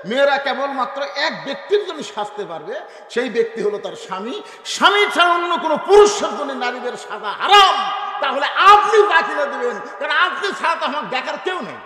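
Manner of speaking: high, loud sermon cry; full-force preaching. Real, not acted. Preacher telling women whom to dress for, full-force preaching. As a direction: thunder the ruling out to the crowd.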